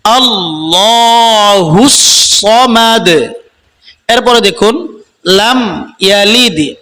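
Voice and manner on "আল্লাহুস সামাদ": সামাদ with a heavy emphatic ص, slow chant; tajweed recitation. Shown as a demonstration. Teacher gives the correct version, slow chant. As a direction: recite slowly with the proper সোয়াদ. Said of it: "এভাবে পড়লে পড়া হবে না".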